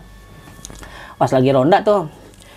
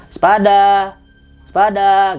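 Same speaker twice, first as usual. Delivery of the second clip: louder sepada called at a door; calling out greeting; 3 semitones higher, 4 dB louder, much slower than usual. Retold or imitated. Boy calling sepada at the lady's door. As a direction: call sepada out to the house. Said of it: "Gue bilang".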